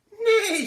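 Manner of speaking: very high, loud whinny with a laugh; loud horse neigh, giggling. A narrator's reading. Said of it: "said the horse".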